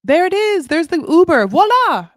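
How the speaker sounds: high, loud showy exclamations; excited mock announcing. Traveler announcing the Uber has come, loud excited mimicry. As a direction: announce each thing like a grand reveal. Mimicking constant voilas on the trip.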